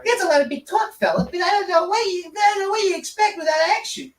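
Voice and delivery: high squeaky newborn rat; rat baby voice. Acted. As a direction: talk like a tiny tough rat baby. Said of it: "I'm the baby she just gave birth to".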